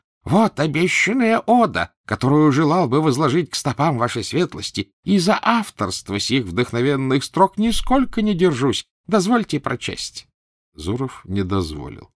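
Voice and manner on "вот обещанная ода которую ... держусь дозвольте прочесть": much higher, louder fawning cry; eager poet. A narrator's reading. Poet offering his ode to the prince, high eager cry. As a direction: gush it out, begging attention.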